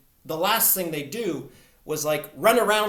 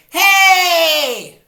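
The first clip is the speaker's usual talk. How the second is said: high, loud forced yell; pushed shout; 12 semitones higher, 13 dB louder than usual. Shown as a demonstration. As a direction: yell it, pushing hard. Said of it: "without going".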